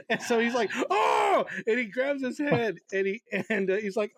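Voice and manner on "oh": a high, loud yelp of pain; pained cry. Retold or imitated. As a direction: yelp as if you just hit your head. Dan crying out in pain.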